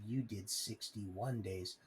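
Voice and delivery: low, soft and slow, "You did sixty-one days" drawn out cold; a quiet gloating threat; menacing taunt. Retold or imitated. Prosecutor taunting him after the deal: low slow menace.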